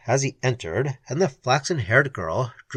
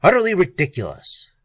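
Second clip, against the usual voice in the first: hasty; 6 semitones higher, 5 dB louder than usual. Acted. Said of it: "repeated hastily".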